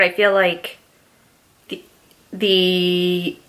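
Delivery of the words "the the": hiccups. Real, not acted. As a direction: caught by a hiccup. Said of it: "me having the hiccups".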